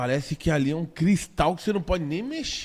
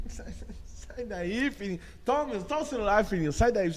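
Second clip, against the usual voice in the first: much softer, sing-song "Sai daí, filhinho"; "Sai daí" coaxed gently, begging; mock pleading parent; 2 semitones higher, 6 dB quieter than usual. Mocking other parents begging their child to move, soft sing-song.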